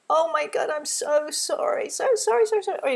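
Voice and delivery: high, loud, overwrought so sorry; a frantic, self-flagellating sorry; guilty gushing apology. Shown as a demonstration. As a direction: pile on the guilt and shame. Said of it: "in a way that is really, we're guilting ourselves".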